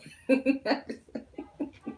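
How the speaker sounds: high, breathy giggle; helpless laugh. Real, not acted. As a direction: break into a helpless, airy laugh. Laughs while trying to calm down.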